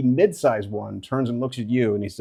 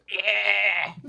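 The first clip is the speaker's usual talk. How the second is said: higher creature yelp of agreement; a lightly voiced skittish critter; Hiss; 7 semitones higher than usual. Hiss agreeing nervously, pitched higher. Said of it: "Hiss goes".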